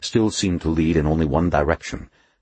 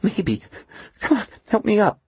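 frightened Duffy voice. A narrator's reading.

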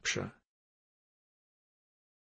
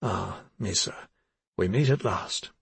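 lovely smile; 3 semitones lower than usual. Acted. Inspector greets the frightened girl, smiling. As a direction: greet her gently with a smile. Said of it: "with quite a lovely smile".

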